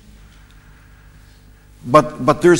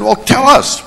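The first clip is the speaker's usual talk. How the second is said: higher, louder eager interviewer voice; pushy TV reporter; 11 semitones higher, 6 dB louder than usual. Retold or imitated. US reporter pressing a foreign colleague, eager and loud. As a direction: push the mic at him, coaxing loudly.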